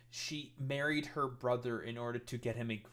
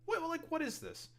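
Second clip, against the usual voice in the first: very high squeak of disbelief; incredulous. Real, not acted. Commentator baffled by the post he is reading, incredulous. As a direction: voice leaping way up, baffled.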